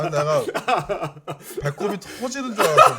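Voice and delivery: laughing loudly while talking; bursting with laughter, loud and high; laughing hard. Real, not acted. Laughing about the hosts' banter. (크게 웃으며)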